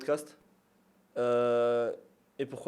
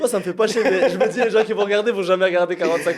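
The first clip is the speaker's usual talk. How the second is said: high, loud, amused objection; loud protest; 7 semitones higher, 11 dB louder than usual. Real, not acted. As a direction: protest loudly over the laughter. Protestation animée, en riant. (protestation forte et rieuse)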